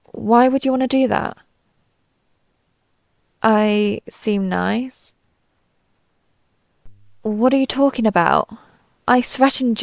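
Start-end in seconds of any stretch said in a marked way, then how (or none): 3.4-5.1 slow, puzzled, rising at the end; baffled echo question. Acted. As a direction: draw it out as a disbelieving question.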